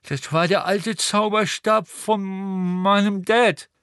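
put-on high, slow, nasal-ish boy mumbling through a broken nose; mumbling Neville. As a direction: Neville grumbling to himself. Neville mourns his father's wand.

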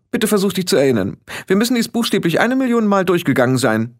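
put-on high-pitched, exasperated bossy girl; impatient and superior; haughty young witch leader. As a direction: scold a forgetful follower, pitched high.

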